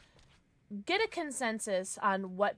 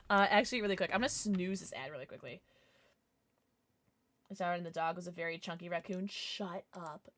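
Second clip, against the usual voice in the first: usual speaking voice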